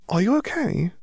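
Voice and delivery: put-on high, gentle child's question; a child checking on a friend; concerned little girl. A narrator's reading. Columba asks worried.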